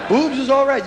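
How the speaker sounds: ordinary talk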